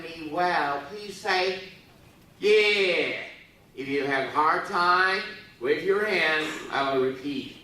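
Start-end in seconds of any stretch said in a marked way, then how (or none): none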